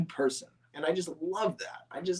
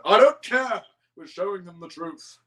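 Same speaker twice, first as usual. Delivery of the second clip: defiant Fat Controller; 2 semitones higher, 7 dB louder than usual. Retold or imitated. Fat Controller brushes off Edward, loud then hushed. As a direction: brush off the question.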